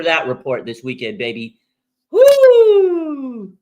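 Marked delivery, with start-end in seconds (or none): 2.1-3.5 loud high whoop; hype yell. Real, not acted. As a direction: let out a huge victory whoop. Celebrating the hire.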